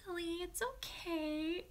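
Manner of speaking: calm the whining pup gently; tender pet talk; soothing the dog. Real, not acted.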